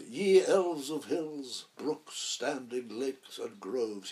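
grand Prospero speech. Retold or imitated. Old actor reciting Prospero's speech, slow and grand. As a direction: declaim it like an old actor at full stretch. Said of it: "he embarked on this lengthy speech".

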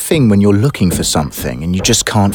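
low, reflective voice-over; story narration. Acted.

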